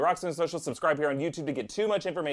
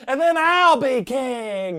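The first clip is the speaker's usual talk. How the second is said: high, loud, drawn-out proclamation; would-be boy king; 10 semitones higher, 7 dB louder, much slower than usual. Pretend. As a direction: declare it slowly in mock triumph. Joins in as the waiting kid.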